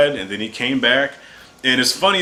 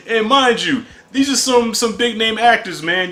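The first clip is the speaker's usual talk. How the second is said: laughing while talking; 9 semitones higher than usual. Real, not acted. Still laughing about X-Force.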